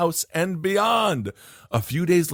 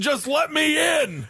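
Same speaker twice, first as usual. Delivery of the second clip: put-on Lancashire accent; 13 semitones higher than usual. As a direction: shout up high in a Lancashire accent to be let in. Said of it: "in a strong Lancashire accent".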